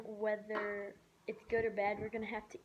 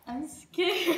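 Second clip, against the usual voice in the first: nervous laugh; 6 semitones higher, 10 dB louder than usual. Real, not acted. About to taste blindfolded.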